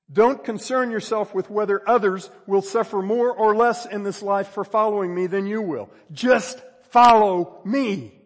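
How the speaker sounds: high, loud sermon climax; full-force preaching. Real, not acted. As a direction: thunder it out as a charge to the congregation.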